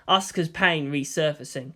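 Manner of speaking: usual speaking voice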